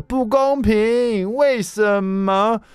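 put-on very high, drawn-out whining kid voice; whiny child. Father imitating his son Jason complaining unfairness, whiny. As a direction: whine like a sulky eight-year-old. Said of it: "我又听到Jason说".

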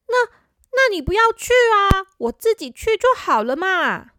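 little brother voice. Acted. Little brother pleading with sister.

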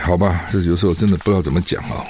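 ordinary talk, nothing marked

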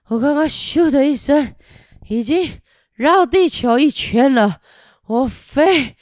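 loud dragon running out of steam, slowing at the end; tiring dragon. Acted. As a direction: brag then give out. (飞不动的喷火龙)

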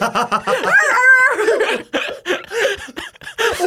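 shrieking laugh. Real, not acted. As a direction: scream with laughter. Bursts out at a funny image.

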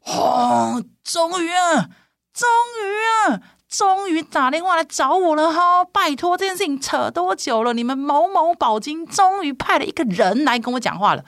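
high, mock triumphant slow finally; fired up. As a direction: gloat that they finally called. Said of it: "我那时候整个战斗力狂升".

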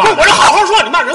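comic outrage at being insulted; indignant yelling. Acted. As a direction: protest at full volume, high-pitched. Acted row over an insult.

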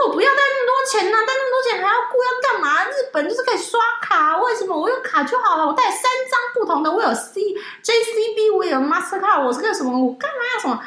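high mocking whine of her own past self; whiny younger self. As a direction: squeal it like a stubborn kid refusing. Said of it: "我说".

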